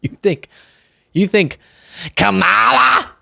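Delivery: mock-incredulous sneer, rising on Kamala; sneering you-can't-be-serious tone; condescending mockery. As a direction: imitate a smug voter talking down. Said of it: "they would condescendingly go".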